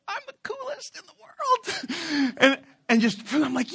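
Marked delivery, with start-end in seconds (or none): 0.1-2.4 very high, drawn-out squeal; inner triumphant cry. Retold or imitated. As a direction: squeal it slowly in mock triumph. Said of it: "in my heart, I'm like".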